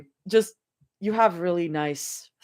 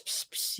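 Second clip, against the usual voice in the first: rain sound; 14 dB quieter than usual. Retold or imitated.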